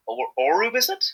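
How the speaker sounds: tentative "Orub", lifted high into a question; stumbling on "Orub", voice rising to check it; unsure pronunciation. Real, not acted. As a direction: try "Orub" haltingly, rising as if asking. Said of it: "I can't pronounce her name right".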